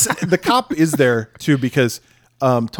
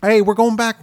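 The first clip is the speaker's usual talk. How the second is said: calling out; 9 semitones higher than usual. Retold or imitated. Buddies call out to missing friend.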